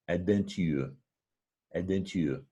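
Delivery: adventure said the French way, ending in a rounded tu; adventure, lips pursed on the last syllable; French pronunciation. Shown as a demonstration. Teacher says the word in French for comparison. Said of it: "So that's the word in French".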